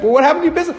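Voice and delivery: high, loud protesting question; incredulous protest. Acted. A man protesting to the heavenly judge, high indignant plea.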